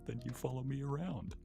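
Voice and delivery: usual speaking voice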